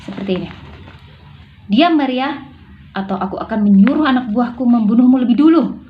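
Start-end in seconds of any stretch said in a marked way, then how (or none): 1.7-5.8 sharp, louder threat; Natasha snapping. Shown as a demonstration. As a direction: silence her with an angry snap. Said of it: "Natasha membentak".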